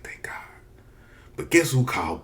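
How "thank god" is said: breathy whispered thank God; a whispered sigh of relief. Retold or imitated. Relief, parents didn't answer.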